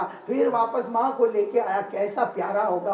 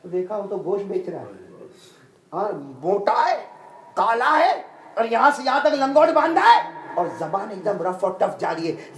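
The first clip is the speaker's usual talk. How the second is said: ordinary delivery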